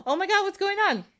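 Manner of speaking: high, alarmed cry; shocked princess. Acted. Princess watching husband turn old, crying out high in alarm.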